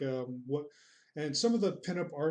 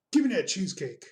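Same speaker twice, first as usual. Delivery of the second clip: loud, higher burst of craving; mock demand; 4 semitones higher, 7 dB louder, faster than usual. Streamer craving cheesecake mid-stream, mock demanding. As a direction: demand it loudly, playfully.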